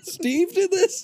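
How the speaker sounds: high, slow Steve did this; disbelief. Real, not acted. Hearing the Steve story, slow high disbelief. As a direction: stretch it out in amazement. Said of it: "reacting in disbelief".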